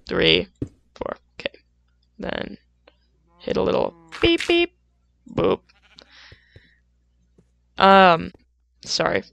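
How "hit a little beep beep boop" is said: high squeaky beep beep boop; high beeping noises. Retold or imitated. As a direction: chirp the beeps. Imitating music sounds.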